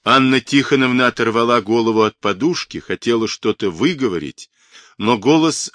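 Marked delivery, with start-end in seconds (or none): none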